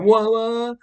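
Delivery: high, loud mock disbelief; hammed-up shouted protest; acted outraged reaction. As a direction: yelp it as a startled, protesting suspect. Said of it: "He's like".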